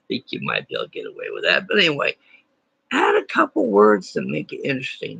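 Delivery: ordinary talk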